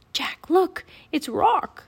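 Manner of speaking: high, urgent whisper. A narrator's reading. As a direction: hiss it to Jack secretly.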